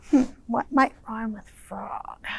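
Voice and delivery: softly whispered question. Real, not acted.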